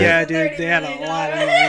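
cracking up mid-sentence; laughing. Real, not acted. Laughing to friends about the movie's big budget, laughing.

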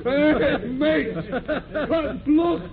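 teasing call. Acted. Sailor calls to a mate.

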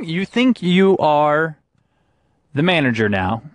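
ordinary talk, nothing marked

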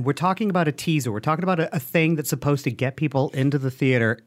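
ordinary talk

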